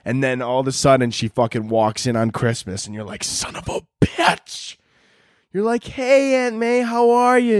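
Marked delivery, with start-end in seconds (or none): 3.2-4.8 slow, breathless hissed curse. Retold or imitated. Aunt unexpectedly walks in. 5.8-7.6 high, bright put-on friendliness; sugary fake hello to the aunt; fake cheerful greeting.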